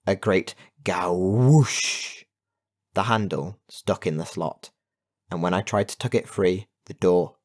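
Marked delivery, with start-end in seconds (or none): 0.8-2.2 long, swelling gawoosh; imitated whoosh. A narrator's reading. Narrator imitating a magic door's sound, drawn-out whoosh. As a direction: draw out the rushing noise. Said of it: "suddenly we heard a sound".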